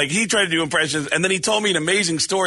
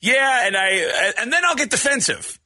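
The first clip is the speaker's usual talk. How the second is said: ordinary delivery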